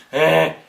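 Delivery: loud, wordless grunt; grunted reply. Acted. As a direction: grunt instead of saying good morning. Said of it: "as opposed to".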